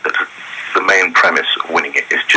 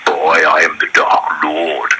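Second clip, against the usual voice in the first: Dark Lord voice; slower than usual. Retold or imitated. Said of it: "And he goes".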